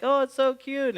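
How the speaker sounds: high, gushing mock coo; mock gushing parent. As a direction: mimic a doting parent cooing over a brat. Mocking parents cooing over kid.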